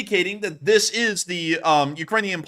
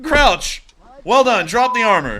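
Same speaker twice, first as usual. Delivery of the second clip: shouted commands; 5 semitones higher, 6 dB louder, slower than usual. Retold or imitated. Soldiers command prisoner.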